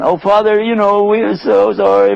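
a touch of false remorse; insincere brothers. Acted. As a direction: fake sympathy to the father. Brothers fake apology.

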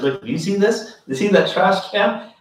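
angry sheikh shows the file, retold; mad sheikh. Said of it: "He was actually mad at America".

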